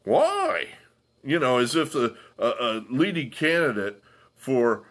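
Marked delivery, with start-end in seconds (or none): 0.0-0.7 high squeaky mock "why?"; imitating a puzzled friend; baffled why. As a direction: play the clueless friend, pitched way up. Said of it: "He was like".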